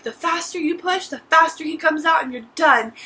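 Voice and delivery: a hint of a nurse pushing her on; urging nurse.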